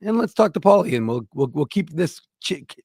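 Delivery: ordinary talk, nothing marked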